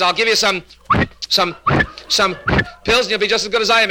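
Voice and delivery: high, sputtering catches on "some"; stuttering psychiatrist. Acted. Psychiatrist promises his patient pills, stuttering. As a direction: stick on the word, then release smoothly. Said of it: "has got the same trouble".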